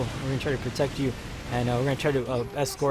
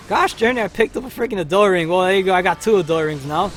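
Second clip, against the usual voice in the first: exasperated outburst; 5 semitones higher, 8 dB louder than usual. Real, not acted. Picks up wrong item mid-fight.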